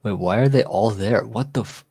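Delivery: ordinary delivery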